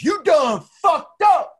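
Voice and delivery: high, loud, slow imitated threat; threatening shout. Imitating the big man threatening him, a slow high shout. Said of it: "and he goes".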